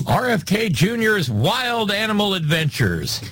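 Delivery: TV announcer voice. Pretend. Announcing a fake TV show.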